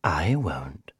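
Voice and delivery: low, whispered reassurance; low and pleasant. A narrator's reading. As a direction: gently put his fear to rest. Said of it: "her voice was low and pleasant".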